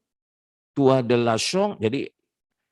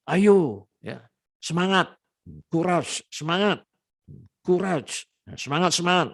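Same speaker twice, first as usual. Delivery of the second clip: cheering on; 8 semitones higher than usual. Shown as a demonstration. Teacher demos cheering encouragement.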